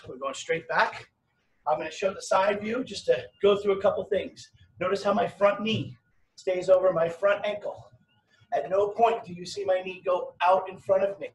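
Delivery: ordinary talk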